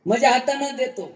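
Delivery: put-on high, proud voice of an old man who holds the keys; boastful patriarch. Patriarch boasting to the preacher that nobody defies him, high and proud. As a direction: crow about your power over the whole family.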